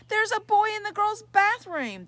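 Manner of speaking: high, loud scream down the hall. Acted. Girl screaming after Jeff leaving her bathroom, loud scream. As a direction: shriek it after him. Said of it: "the girl screamed after him".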